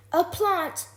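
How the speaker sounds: high, loud outburst of horror; horrified yell. A narrator's reading. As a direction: yell it like an appalled mother. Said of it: "yelled Mum in horror".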